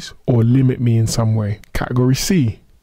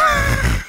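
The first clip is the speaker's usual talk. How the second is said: short high laugh. Real, not acted. Laughing at own podcast joke.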